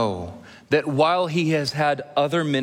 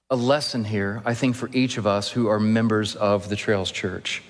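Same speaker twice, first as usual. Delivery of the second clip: normal talking voice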